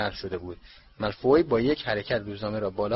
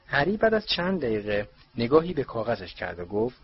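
ordinary delivery, nothing marked

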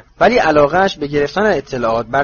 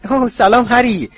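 bright, higher and louder hello; happy greeting; 5 semitones higher, 3 dB louder than usual. Acted. Ginny happily greeting Harry at the door, gladly raised voice. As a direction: greet him with glad surprise. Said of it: "با خوشحالی گفت".

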